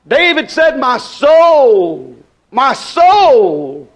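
slow, emphatic pulpit questions; stretched key words, preacher's cadence; impassioned preaching. Acted. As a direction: cry it out to the congregation. Preaches on cast-down soul.

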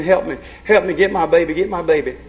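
ordinary talk, nothing marked

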